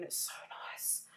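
whispered aside. Real, not acted. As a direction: breathe it out softly. Soft aside.

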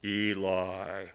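low, loud, drawn-out call of a name; mysterious calling voice. Acted. Voice calling a sleeping boy in the temple, low booming call. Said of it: "he hears this voice go".

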